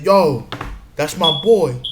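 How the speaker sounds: a loud, sudden yell; loud callout. Retold or imitated. A guy loudly backing up his friend, calling out. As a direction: call it out loud for your friend.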